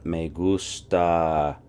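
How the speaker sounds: me gusta drawn out slowly; slow emphasis. Shown as a demonstration. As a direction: spell out me gusta slowly for the class. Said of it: "teacher stresses model phrase".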